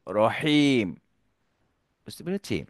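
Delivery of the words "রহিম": ordinary talk, nothing marked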